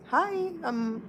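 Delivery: high, lilting greeting; friendly hi. Retold or imitated. Customer greets the cashier at the counter, friendly. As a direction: greet the clerk in a high, sweet voice. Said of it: "I said a friendly hi".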